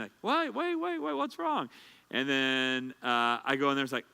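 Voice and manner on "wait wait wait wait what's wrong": high-pitched mock yell after someone; imitating wife yelling. Wife yelling after her husband, high mock yell. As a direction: call out high and anxious like the wife. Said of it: "she's yelling after me".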